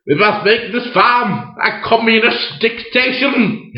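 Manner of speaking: high, slow, grand Napoleon voice; imperial Napoleon. Retold or imitated. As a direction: proclaim it like a pompous pig dictator. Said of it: "And then I was Napoleon".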